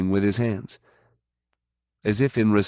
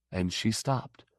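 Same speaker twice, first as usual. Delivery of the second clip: usual speaking voice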